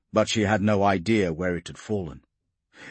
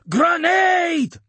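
high, drawn-out warning yell; shouting; 7 dB louder than usual. A narrator's reading. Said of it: "Hanlon managed to shout".

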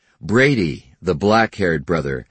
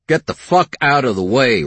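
angry growl; 7 semitones higher, 5 dB louder than usual. A narrator's reading. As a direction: snarl it, loud and rough. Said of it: "Ben growled at the cowboy".

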